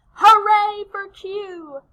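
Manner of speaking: loud, high, drawn-out hooray; cheer. Shown as a demonstration. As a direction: lead a big slow rallying cheer. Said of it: "we're gonna do our Q cheer".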